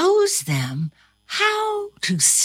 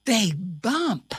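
ordinary delivery, nothing marked

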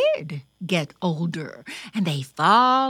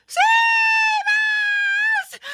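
very high, loud, drawn-out squeal; crying out; 7 dB louder than usual. A narrator's reading. Another little sister begs to be saved, crying out. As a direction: wail it out long and high for rescue. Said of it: "cried another".

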